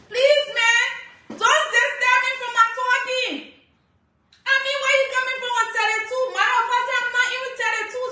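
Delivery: indignant rant. Real, not acted. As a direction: fire back, offended. Indignant over age comments.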